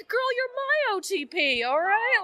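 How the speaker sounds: high mock shout back; playful yell back. Answering a fan in the crowd, high playful mock shout. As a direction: toss it back like a hyped fan. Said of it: "I just screamed back".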